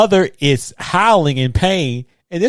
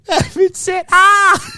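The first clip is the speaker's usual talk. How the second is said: mock scream; 3 dB louder, much slower than usual. Mimicking scream.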